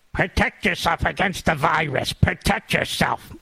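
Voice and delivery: put-on preachy warning, slowing at the end; mock scolding governor. Governor orders everyone to mask up, mock stern warning. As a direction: imitate an official hammering out a safety order.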